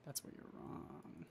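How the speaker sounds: mock-ominous whisper; teasing whispered threat; hushed comeback. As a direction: breathe it low like a villain. Teasing comeback in gaming banter.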